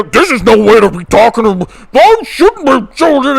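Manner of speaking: high, loud whiny mock of indignant people; mocking outraged voters. Streamer imitates offended conservatives, high and whiny. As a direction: play them as shrill pearl-clutchers. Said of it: "they're all gonna go".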